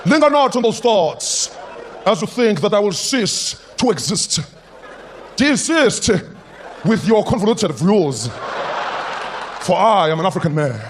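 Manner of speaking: put-on slow, grand stage delivery in a South African accent; grand theatrical South African accent.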